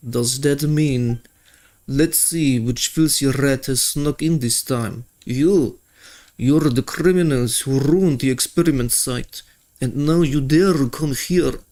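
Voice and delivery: menacing Fatui guard with a bad Russian accent. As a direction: sneer it out in a mock Russian accent. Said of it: "sorry for my very bad Russian accent".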